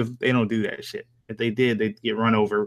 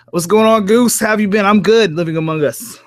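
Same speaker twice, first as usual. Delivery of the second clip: excited greeting; 9 semitones higher, 6 dB louder than usual. Real, not acted.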